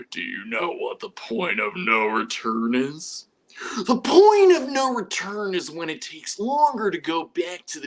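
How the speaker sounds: slow, menacing movie-villain monologue over the phone. Retold or imitated. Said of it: "he was quoting verbatim, uh, [name]'s lines".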